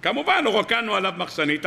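normal talking voice

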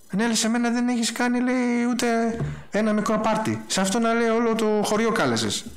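high, whining complaint from the jealous son; elder son whining to his father about the feast; aggrieved elder son. Acted. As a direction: protest like a wronged son who was never thanked.

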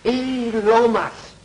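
high, drawn-out hail; surprised greeting. Acted. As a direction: greet an old pal you never expected. Unexpectedly meets an old acquaintance.

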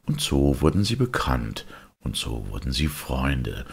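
usual speaking voice

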